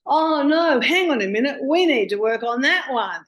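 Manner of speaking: high and loud insistence; a loud, high "oh no, hang on"; stopping the client with a loud, high protest; emphatic exclaim. Retold or imitated. Insisting on changing belief.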